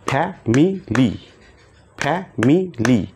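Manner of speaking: ফ্যামিলি slow in three beats, fa-mi-li; slow clapped syllables. Shown as a demonstration. Teacher claps syllables for students, slow and even. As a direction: clap three times, slowly: ফ্যামিলি. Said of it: "আমরা তালির মাধ্যমে সেই ওয়ার্ডগুলোকে শিখব".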